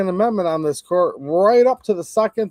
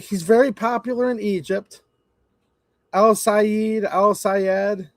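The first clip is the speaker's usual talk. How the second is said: higher, sneering echo of "very popular"; mocking repeat; 4 semitones higher, slower than usual. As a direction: mimic them with open scorn. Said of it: "I'm like".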